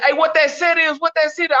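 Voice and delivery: high-pitched mock yell, no real volume; retold yelling. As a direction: imitate inmates hollering a challenge. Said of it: "they, they yelling".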